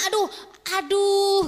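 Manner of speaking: high, loud staged ouch, ouch; mock pain cry. Demonstrating delayed pain to the audience, loud mock ouch. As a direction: cry out as if the pain just arrived. Acting pain from a pinched finger.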